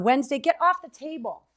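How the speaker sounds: high, firm call to the dog; scolding the dog. Real, not acted. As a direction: shoo the dog off the table. Scolding her dog off the table.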